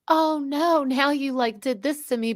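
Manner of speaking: high, put-upon mock whine; mock whiny complaint. Cohost mimics the castmate playing victim, high mock whine. Mocking Jennifer's whiny excuse.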